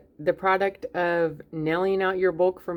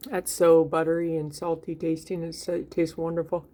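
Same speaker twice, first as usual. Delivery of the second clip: talking with a mouth full; words round a bite of bread. Real, not acted. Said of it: "speak with my mouth full".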